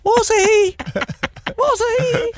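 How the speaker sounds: very high mock squeal; mimicked pet call. Co-host mocking her call to Warren, squeaky pet voice. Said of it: "if you just went".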